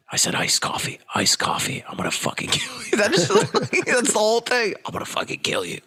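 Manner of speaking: hushed menacing whisper; breathy mock-threatening whisper; Alec Baldwin whisper. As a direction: snarl it under your breath. Continuing Baldwin impression.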